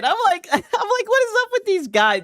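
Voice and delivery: high laughing disbelief. Retold or imitated. Mocking reaction.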